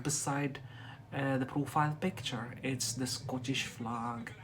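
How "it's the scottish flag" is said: ordinary delivery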